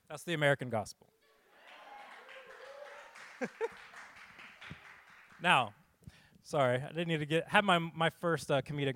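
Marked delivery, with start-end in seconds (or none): 1.9-3.7 falsetto laugh. Real, not acted. Laughs after the trailer bit.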